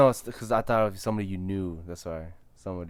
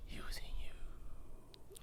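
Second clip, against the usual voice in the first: whisper; 15 dB quieter, much slower than usual. Real, not acted. Hinting the ex's hidden plan.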